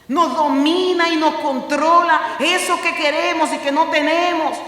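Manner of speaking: loud, high impassioned sermon voice; fervent preacher at full volume; full-force preaching. Acted. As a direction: preach it out, rising and pressing.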